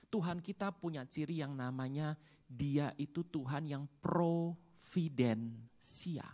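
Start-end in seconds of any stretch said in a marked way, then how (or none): 4.0-6.3 slow hushed whisper on providensia; whispered word. Real, not acted. Preacher hushing the key term to the congregation. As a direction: breathe out "providensia" slowly. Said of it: "whispered key word".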